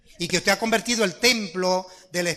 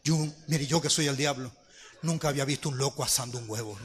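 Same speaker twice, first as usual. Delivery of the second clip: devil; 7 semitones lower, 8 dB quieter than usual. Retold or imitated. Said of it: "se apareció el diablo. Y le dijo".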